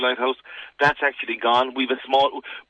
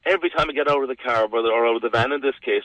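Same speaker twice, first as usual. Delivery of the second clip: normal talking voice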